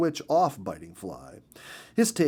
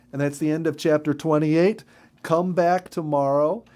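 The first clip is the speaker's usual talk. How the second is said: louder, brighter closing to listeners; storyteller saying goodbye until tomorrow; cheery sign-off; 4 semitones higher, 6 dB louder than usual. Acted. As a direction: wrap up the chapter for the kids.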